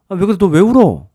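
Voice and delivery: put-on high, loud worried call to a crying child; alarmed mother. Mother at the door calling out to her sobbing son, loud and alarmed. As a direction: mom rushing to ask what's wrong.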